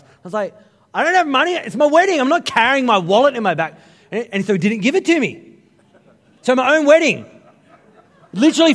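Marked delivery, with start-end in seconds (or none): 0.9-3.8 high, loud frantic protest; high, loud exasperated plea. Retold or imitated. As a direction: beg in disbelief. Pleading with barman.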